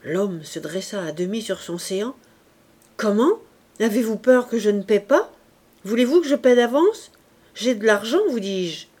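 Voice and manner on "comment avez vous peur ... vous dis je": loud, rising indignation; indignant protest. A narrator's reading. Traveler protesting to the innkeeper, loudly indignant. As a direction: push back hard, offended he might not pay.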